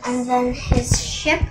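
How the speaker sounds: ordinary delivery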